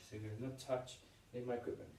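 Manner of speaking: ordinary talk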